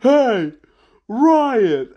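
high, loud shouted hey riot; mock rally shout. Chit Sang hoisting a prisoner, shouting to start the riot. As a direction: yell it like starting a brawl. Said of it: "and he just says".

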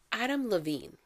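ordinary talk

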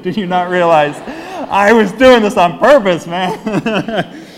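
loud, high mock scolding; amused, hammed-up exasperation; exasperated, laughing. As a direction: tell off a friend through laughter. Retold scolding of friend, laughing.

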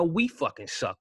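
usual speaking voice

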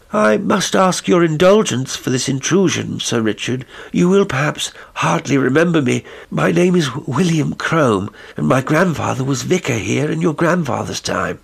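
lightly voiced courteous stranger interrupting; polite visitor. Crome introduces himself.

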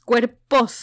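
loud, stunned cry of the word; shocked exclamation. Retold or imitated. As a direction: blurt it out in disbelief. Shocked at three bodies. (exclamación de asombro)

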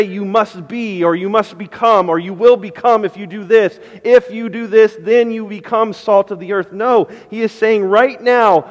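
loud, high, building sermon delivery; full-force preaching. Acted. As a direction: preach it out to the whole room.

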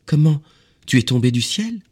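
put-on high, loud child-like exclamation; astonished cry. A narrator's reading. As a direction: cry out in amazement like a small boy. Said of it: "Alors il s'écria".

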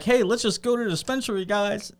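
high, breezy carefree invite; a light, happy-go-lucky stoner; cheerful stoner. Retold or imitated. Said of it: "it's not gonna be like".

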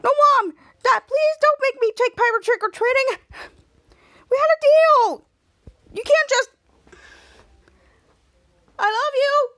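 high, loud begging wail; desperate pleading. Retold or imitated. Piper begging her parents not to go, in a high, loud wail. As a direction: beg Mom and Dad not to leave.

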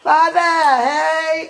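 high, loud, drawn-out playful holler; acted tipsy whooping. Pretending to greet Father on wine night, drawn-out playful whoop. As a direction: call out long and high, hammed-up. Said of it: "I'm really gonna be like".

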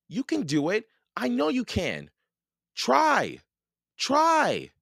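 high cheering, building to loud cries; urgent encouragement. A narrator's reading. Jack cheering on sinking Annie, increasingly urgent.

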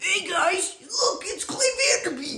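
very high, squeaky mock kid voice; imitating his younger self. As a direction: pipe it up high as a self-mocking little kid. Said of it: "I talked like that".

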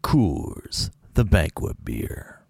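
imperfect gravelly beer-ad impression; Sam Elliott. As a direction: try a serious, drawn-out slogan read. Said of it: "it's no Sam Elliott".

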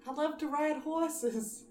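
slow, put-on southern drawl; southern accent. Friend dares her to try a southern accent, slow drawl. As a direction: lean into a cowboy southern accent. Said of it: "I can't say with a southern accent".

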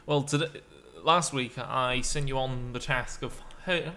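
normal talking voice

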